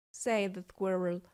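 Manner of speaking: ordinary delivery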